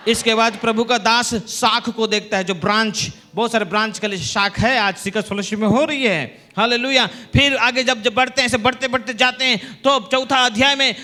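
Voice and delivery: energetic preaching. Real, not acted. Preacher explaining the two trees to congregation, energetic preaching.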